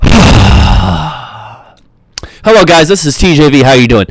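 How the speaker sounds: mock sigh, then a loud video greeting; sigh before the greeting. Shown as a demonstration. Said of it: "just like me in the beginning of every video, I go".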